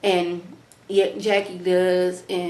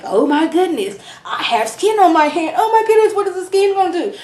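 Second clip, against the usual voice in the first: high, loud, hammed-up mock panic; dramatic Jackie; 9 semitones higher, 4 dB louder than usual. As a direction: overplay her melodrama about nothing. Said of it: "Her being all dramatic".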